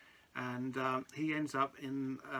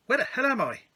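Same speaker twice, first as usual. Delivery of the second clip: sudden high, loud surprised cry; mock surprise; 11 semitones higher, 9 dB louder than usual. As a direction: act shocked to be missing. Host mock-surprised at missing self.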